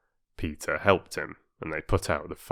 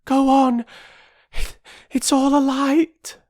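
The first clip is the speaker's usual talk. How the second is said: put-on high, loud girl, breathless; girl urging the mother on, breathless; out of breath; 6 dB louder than usual. A narrator's reading. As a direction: gasp it out, no breath left. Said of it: "She had no breath for more".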